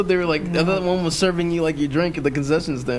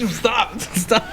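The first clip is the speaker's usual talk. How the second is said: high, laughing plea to stop; laughing protest; 7 semitones higher than usual. Real, not acted. Begging co-host to quit the bit, laughing. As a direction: beg him to stop through real laughter. Laughing at co-host's deadpan joke.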